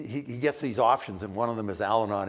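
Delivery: ordinary talk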